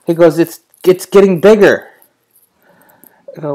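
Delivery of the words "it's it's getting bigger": put-on higher, louder little-kid voice; imitating son. Dad quoting his three-year-old son, high kid voice. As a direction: play the toddler insisting.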